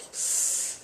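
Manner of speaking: long weary exhale; heavy sigh. Retold or imitated. Fed-up customer sighing at the clerk. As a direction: sigh out the frustration. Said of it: "I'm like".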